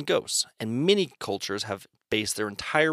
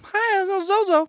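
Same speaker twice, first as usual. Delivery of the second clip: put-on very high, slow little girl; 5 dB louder, slower than usual. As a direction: squeak it like a small girl. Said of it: "sounds like a little girl's name".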